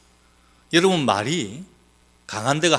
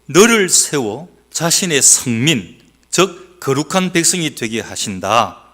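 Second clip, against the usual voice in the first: slow scripture reading; 5 dB louder, slower than usual. Acted. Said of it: "오늘 구 절 말씀에".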